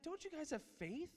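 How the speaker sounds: a light impression of Jesus scolding; Jesus chiding. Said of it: "he said".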